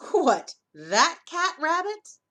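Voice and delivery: high, loud, slow disbelief; mock the silly creature; scoffing surprise. Dingo scoffs at kangaroo.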